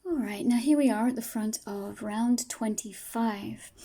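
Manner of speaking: ordinary delivery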